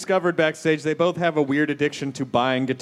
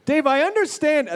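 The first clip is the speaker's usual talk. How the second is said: blurted opener; 12 semitones higher than usual. Shown as a demonstration. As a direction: blurt it out too soon. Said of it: "Wait, I don't think I did that right".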